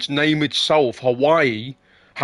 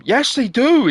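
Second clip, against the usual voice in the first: higher and louder, flat contradiction; insisting; 9 semitones higher, 4 dB louder than usual. Real, not acted. Contradicting his friend about Liverpudlians, loud insistence. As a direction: shut down his objection.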